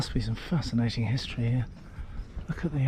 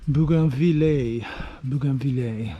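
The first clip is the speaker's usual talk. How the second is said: Bougainvillea said the French way, slow, stress on the end; a careful, self-mocking French Bougainvillea; French accent; slower than usual. Shown as a demonstration. Vlogger trying the French name for viewers, in a French accent. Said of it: "Excuse my poor French accent".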